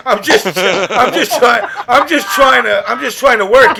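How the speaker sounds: laughing through a stammered protest. Real, not acted. Host defending himself to his guest, laughing. Cracking up while explaining himself.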